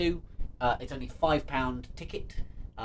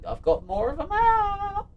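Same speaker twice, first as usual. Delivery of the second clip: high squeaky voice; 5 dB louder than usual. Pretend. Voicing the puzzle face.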